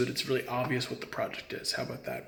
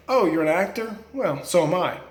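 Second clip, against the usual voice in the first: higher, sneering put-on voice of a know-it-all amateur; mocking wannabe; 4 semitones higher, 3 dB louder than usual. Mimicking amateurs who dismiss his craft, sneering and higher. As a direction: scoff like someone who thinks YouTube makes them an actor. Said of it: "where they're like".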